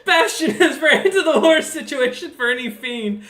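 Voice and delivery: loud, high, amused outburst; laughing. Real, not acted. Game master teases the fiend's player about his situation, laughing.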